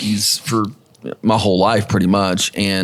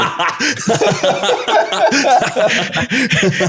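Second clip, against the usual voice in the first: high burst of laughter; 12 semitones higher than usual. Real, not acted. Laughs at the hat trick.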